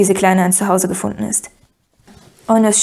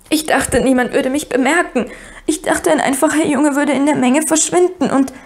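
higher-pitched, upset rush of explanation; tearful outburst; 6 semitones higher than usual. A narrator's reading. Weeping girl explains her boy's disguise, upset and high.

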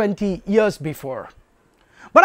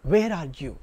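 lower, softening tender call; loving search; 8 semitones lower than usual. Acted. God seeking man to show love, tenderly calling. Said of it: "in search of him to show his love".

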